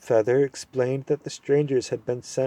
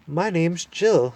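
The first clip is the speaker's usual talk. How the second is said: put-on higher, slow eager girl; girl's voice; 7 semitones higher, 3 dB louder, slower than usual. Jill eagerly introducing herself to the dwarf. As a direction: say it slowly and eagerly.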